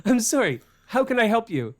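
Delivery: talking over music in a helmet. Retold or imitated. Second louder repeat over music.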